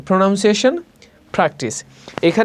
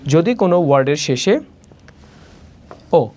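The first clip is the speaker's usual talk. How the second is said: ordinary talk, nothing marked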